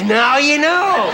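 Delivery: slow, drawn-out punchline; husband drawling back at his wife; dry punchline. Retold or imitated. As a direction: let it land slowly.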